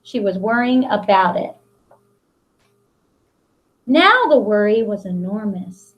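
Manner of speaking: put-on reading a picture book aloud to children; expressive picture-book read-aloud; storybook narration. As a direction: tell the story to the class with long pauses.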